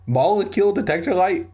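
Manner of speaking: high, quick incredulous repeat. Real, not acted. Reader mocks the book's gadget.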